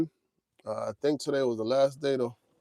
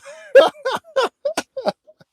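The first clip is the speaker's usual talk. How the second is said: very high, loud cackle; real burst of laughter; 12 dB louder than usual. Real, not acted. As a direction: crack up loudly. Laughing at timeout message.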